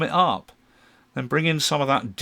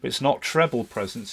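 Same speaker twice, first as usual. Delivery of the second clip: ordinary talk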